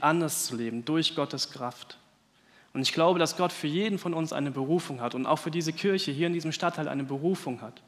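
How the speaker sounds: usual speaking voice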